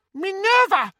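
very high, squeaky little wizard; squeaky Flitwick. Acted. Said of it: "said a squeaky voice".

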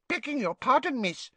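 high, humble elf voice; bow and squeak it politely; house elf. Acted. House elf politely correcting Hermione, high and humble. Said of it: "said the house elf".

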